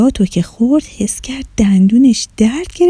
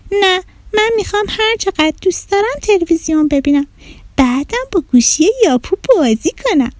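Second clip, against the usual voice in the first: put-on defiant child; 9 semitones higher than usual. Stubborn child refuses mother.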